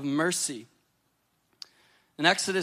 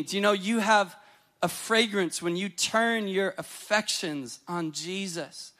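ordinary talk

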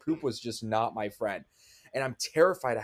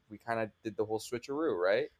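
ordinary talk

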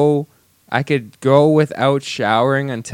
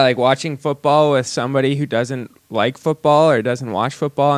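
normal talking voice

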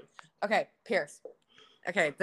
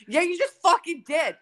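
high, loud comeback in a squabble; mock accusing yell; 9 semitones higher, 7 dB louder than usual. Acting out brothers' gaslighting argument, mock accusing yell. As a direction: yell it back in mock outrage.

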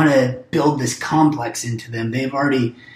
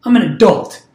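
mock indignant adult; 6 semitones higher, 3 dB louder than usual. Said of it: "and it's like".

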